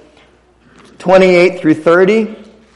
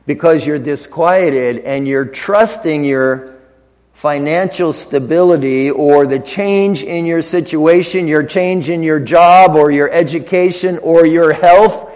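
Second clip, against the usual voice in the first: normal talking voice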